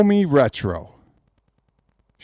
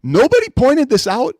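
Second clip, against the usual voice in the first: high rising "Nobody pointed this out?"; "Nobody" asked high and rising in disbelief; a stunned question; incredulous; 10 semitones higher, 3 dB louder than usual. Retold or imitated. I had to watch them back and go.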